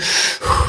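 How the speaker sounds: long unvoiced sigh of mock patience; mock weary sigh. Streamer sighs to viewers about patience, mock weary. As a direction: sigh it out like a bored sage.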